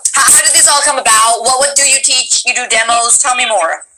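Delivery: ordinary talk, nothing marked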